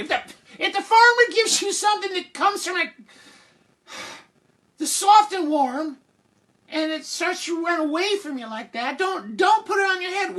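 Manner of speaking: very high, halting mom voice; exasperated mother. Acted. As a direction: strain for patience in a high pitch. Mother instructs Jack.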